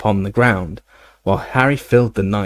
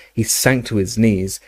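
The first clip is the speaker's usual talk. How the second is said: ordinary delivery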